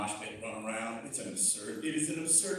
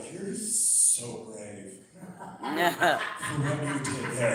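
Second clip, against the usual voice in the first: mock sincere praise; 7 semitones lower, much slower than usual. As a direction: coo it sarcastically.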